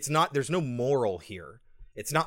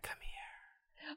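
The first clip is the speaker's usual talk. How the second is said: breathy unvoiced whisper, much softer; mock creepy whisper; 20 dB quieter than usual. Mocking the patient who beckons from behind the curtain, whispered. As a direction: beckon in a spooky hiss. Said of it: "whispering to me like that".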